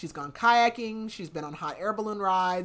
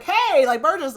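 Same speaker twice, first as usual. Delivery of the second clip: loud, high hail to someone across the room; calling out; 9 semitones higher, 12 dB louder than usual. Retold or imitated. Sig hailing Burgess as she stands by, calling out loudly. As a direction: shout a sudden excited hey. Retold character calls Burgess over.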